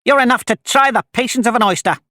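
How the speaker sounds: high-pitched, exasperated retort; snappish. A narrator's reading. Young crab scolds her mother, snappishly. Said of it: "a little snappishly".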